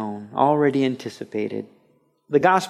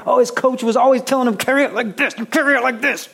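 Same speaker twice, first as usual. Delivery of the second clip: much higher, fast, mocking fan voice; griping fan; 10 semitones higher, faster than usual. Fan complaining the running back ignored his coach, high mocking whine. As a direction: whine like a frustrated fan in the stands.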